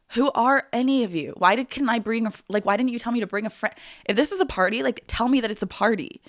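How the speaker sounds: retold indignant outburst, loud and high; frustrated. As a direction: re-enact an exasperated complaint at the host. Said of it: "so frustrated".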